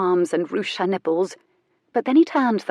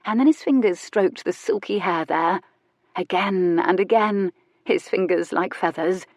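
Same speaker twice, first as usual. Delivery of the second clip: ordinary delivery, nothing marked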